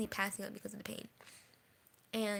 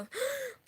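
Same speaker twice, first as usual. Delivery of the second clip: sharp high gasp; crying gasp. Shown as a demonstration. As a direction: demonstrate a big sucked-in sob breath. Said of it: "when you cry, you go".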